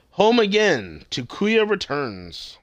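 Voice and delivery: emphatic episode title, drawn out to finish; title announcement. Shown as a demonstration. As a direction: announce the episode name with a flourish.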